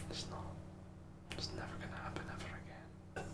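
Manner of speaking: fast unvoiced whisper, rushed. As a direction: hiss it as a grim secret. Dark aside after sounds simple.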